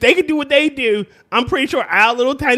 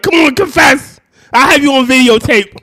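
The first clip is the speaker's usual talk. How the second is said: loud, raised mock accusation; mock interrogation; 3 semitones higher, 7 dB louder than usual. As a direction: press the suspect with play-acted force. Acts out an interrogation.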